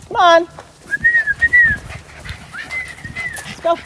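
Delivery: bright high call across the field; calling the dogs. Real, not acted. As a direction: sing out to get the dogs moving.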